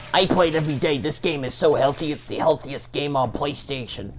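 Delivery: lightly voiced boastful player; mocking imitation. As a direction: parody the defensive fan. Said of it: "He's like".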